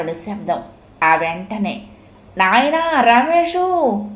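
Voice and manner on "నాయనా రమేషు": high, loud, slow drawn-out call; mother's cry. Acted. Mother recognises her lost son and cries out, long and loud. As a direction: wail the name across the temple. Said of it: "కేక పెట్టింది తల్లి".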